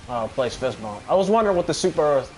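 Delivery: ordinary talk, nothing marked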